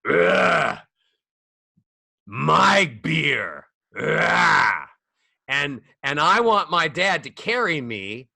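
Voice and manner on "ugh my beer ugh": louder, grunting teamster dad; hammed-up gruff teamster groan. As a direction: grunt it out like a beer-loving tough guy. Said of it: "like a teamster".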